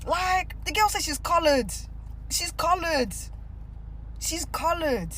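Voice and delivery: high-pitched, exasperated repetition; high, incredulous insistence. Real, not acted. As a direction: hammer it home, pitched well up. Insisting on another's stated identity.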